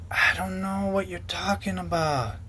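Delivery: slow, soft mocking of his denial; lightly voiced feigned ignorance; mock evasive. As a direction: play dumb, drawn out. Mimicking the leader's evasive reply.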